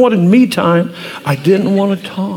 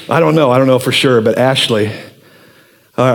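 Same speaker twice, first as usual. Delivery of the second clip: ordinary talk, nothing marked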